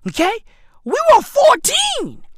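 shouting that they were only fourteen; emphatic shout. Real, not acted. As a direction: drive the point home, shouting. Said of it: "please note, okay?".

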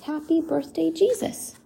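a light, high hushed greeting; sign's greeting. Acted. Porcupine's sign read to the child, hushed and high. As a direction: breathe it softly like a secret. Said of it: "the sign that the porcupine's writing says".

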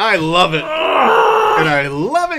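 real excited cheer at the pick. Real, not acted. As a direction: cheer the surprise choice.

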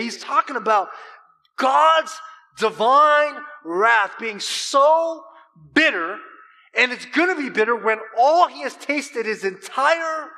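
loud, high, slow emphatic preaching; preaching at full force. Acted. Preacher drives home the bitter cup to the congregation, loud and slow. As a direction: thunder out God's wrath.

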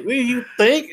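overwhelmed with joy, squealing; stunned excitement. Retold or imitated. Shocked reaction retold.

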